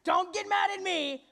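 defensive shout. Acted. Detective dog to angry pets, loud protest. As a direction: push back at the angry crowd.

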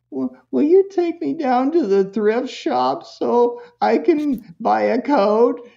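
put-on high, slow, drawn-out kid request; reluctant kid. Son asks Mom for thrift-shop ride, reluctant. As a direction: imitate a child dragging out a request.

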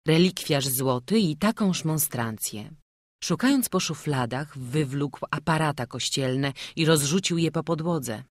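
plain reading voice

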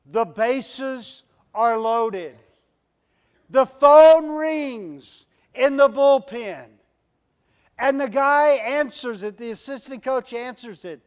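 slow, loud, suspenseful setup; dramatic build-up. Acted. Preacher setting the bottom-of-the-ninth scene, slow and loud. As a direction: paint the tense moment slowly.

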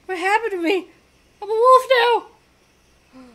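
high-pitched, hammed-up shock; bewildered alarm. Player voicing the hero turned wolf, bewildered. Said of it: "he's just like".

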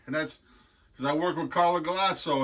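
ordinary talk, nothing marked

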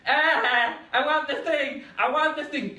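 high, loud tantrum whine; whiny child. Retold or imitated. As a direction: wail like a kid who wants the thing. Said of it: "seen a child going".

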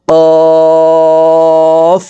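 "কফ" said thick from the back of the tongue, held long on a high level tone; heavy, stretched qaf. Shown as a demonstration. Teacher demonstrating qaf, long heavy chanted hold. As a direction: draw out a heavy "কফ" as a steady note. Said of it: "চার আলিফ টেনে".

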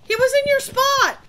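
very high, loud exclamation; high-pitched shocked outburst; shocked yell. Real, not acted. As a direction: yell it in disbelief at a near miss. Startled reaction in ghost game.

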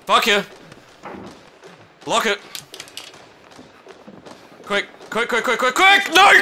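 loud panicked shouting; being chased. Real, not acted. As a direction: yell in panic, running for your life. Fleeing enemies in game.

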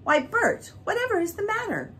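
high, quick startled question; surprised concern. A narrator's reading. Mary asking why Bert looks so excited, surprised. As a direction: catch him out in surprise. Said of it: "Mary startled by Bert".

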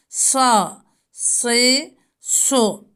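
"Sa, si, su" heavy and thick, never a thin S; thick Sat. Shown as a demonstration. Teacher shows the always-thick Sat to students. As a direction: keep the vowel full. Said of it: "Her zaman sa olarak kalın okunur".

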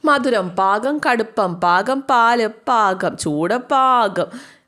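slow, drawn-out gloating over each word; teasing relish. Acted. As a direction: savour every word to make him jealous. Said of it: "ഇവനെ കൊതിപ്പിച്ചുകൊണ്ട്". (കൊതിപ്പിക്കാൻ നീട്ടി ആസ്വദിച്ച് പറയുന്നു)

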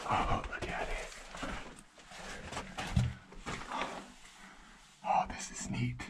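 whispering in awe at the find; hushed whisper. Real, not acted. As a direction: breathe it out in wonder. Awed whisper exploring mine.

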